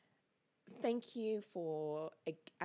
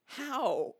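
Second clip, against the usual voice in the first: excited; 9 semitones higher, 3 dB louder than usual. Real, not acted. Overjoyed exclaim.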